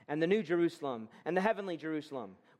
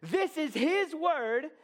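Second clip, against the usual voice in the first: preaching shout; 8 semitones higher, much slower than usual. Real, not acted. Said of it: "sort of shouting at you".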